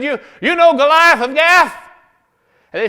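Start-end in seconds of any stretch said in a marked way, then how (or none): 0.0-1.7 loud high challenge; challenging. Acted. David challenging the Jebusites about Goliath. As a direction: shout the question up.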